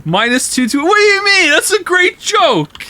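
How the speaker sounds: high, fast squeaky mock outrage; hammed-up high-pitched indignation; mock outraged chatter. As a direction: play an indignant chatter in a shrill falsetto. Mock outraged reply to chat.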